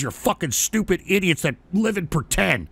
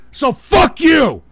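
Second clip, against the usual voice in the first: slow, loud, higher shout; slow loud angry shout; shouted insult; 7 semitones higher, 6 dB louder, much slower than usual. Real, not acted. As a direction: bark it out slowly at them. Ranting insult to viewers.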